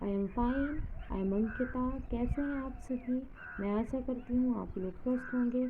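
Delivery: usual speaking voice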